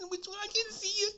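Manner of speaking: high, frantic mock woman; panicky high voice. The woman panicking in the dark, a high mock squeal.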